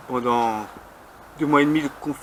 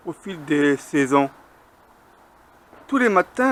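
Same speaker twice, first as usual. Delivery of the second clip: higher, louder measured verse reading; poem recitation; 5 semitones higher, 5 dB louder than usual. Acted. Poet reciting a hen poem at a slam, measured declamation. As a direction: recite it line by line, with pauses and lifted pitch.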